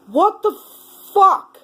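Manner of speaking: exasperated curse. Real, not acted. Outburst after agent's excuse.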